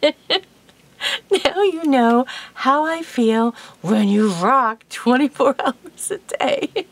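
slow, drawn-out told-you-so with a laugh; slow, smug retort to her husband; gloating payback. Retold or imitated. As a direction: savor each word, teasing him. She turns the tables, laughing.

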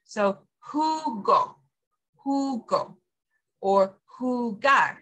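"jugo" and "jugar" slowly with a breathy h at the start; slow teaching "jugo", "jugar" with an airy h; J as H sound. Shown as a demonstration. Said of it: "it goes, huh".

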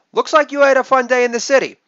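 high, quick imitation of mom; mom's text. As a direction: read mom's text in a mock-cheery high voice. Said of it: "Mom's text".

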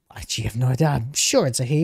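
ordinary talk, nothing marked

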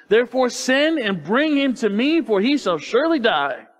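Saul's kindled anger. A narrator's reading. Saul berates his son Jonathan over David, angry. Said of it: "Saul's anger was kindle".

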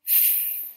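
"f" en long souffle, sans voyelle; son f prolongé. Shown as a demonstration. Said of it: "nous entendons le son". («f» soufflé longuement, sans la voix)

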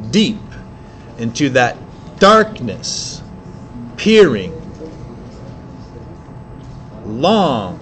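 slow dramatic reading, long pauses; poem recitation. Shown as a demonstration. Teacher reads Poe line aloud, slow and dramatic. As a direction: let each word hang in the dark.

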